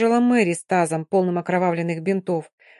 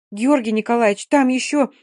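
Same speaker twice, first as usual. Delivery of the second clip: higher, louder, out-of-breath blurt; breathless; 4 semitones higher, 5 dB louder than usual. Acted. Dina breathless, telling the doctor more wounded came. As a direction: gasp it out after running upstairs. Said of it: "задыхаясь, выпалила Дина".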